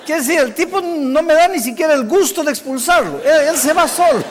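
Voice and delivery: loud, high exasperated outburst; indignación cómica. Retold or imitated. Indignado porque el muchacho se adelanta. (indignado, con la voz alta y aguda)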